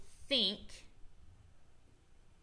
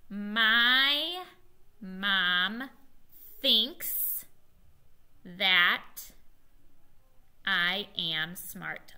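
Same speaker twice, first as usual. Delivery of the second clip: slow sentence modelling; 6 dB louder, much slower than usual. Shown as a demonstration. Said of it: "my sentence is going to be".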